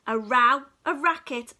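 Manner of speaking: ordinary delivery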